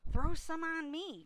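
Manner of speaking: high, loud demand; Avery's cry. A narrator's reading. Boy wanting water thrown on him, high shout. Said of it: "cried Avery".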